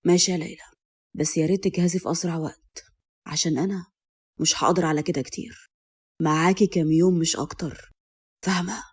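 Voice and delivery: low, soft, cold male character voice; husband's chilly, quiet ultimatum. A narrator's reading. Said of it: "وبعدين قال بلهجته الباردة".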